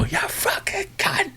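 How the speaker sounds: slow, pitched high, strangled yell; mock road-rage yell. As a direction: yell it through the windscreen. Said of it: "to see me go".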